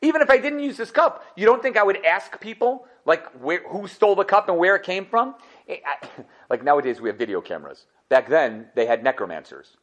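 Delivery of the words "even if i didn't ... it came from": hammed-up exasperated ruler; Yosef scolding. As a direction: scold the brothers as if they should have known better. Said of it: "Rashi says".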